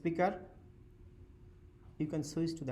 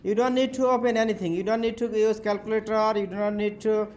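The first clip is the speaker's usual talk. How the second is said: calling out; 6 semitones higher, 10 dB louder than usual. Real, not acted. Loud instructions to whole class.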